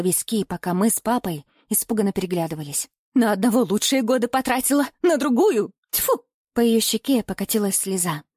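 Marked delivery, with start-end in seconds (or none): 3.1-6.2 indignant rant, pitch climbing; aggrieved, rising to a higher pitch; bitter outburst. A narrator's reading. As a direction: spit it out in disgust. Mother ranting about wasted years.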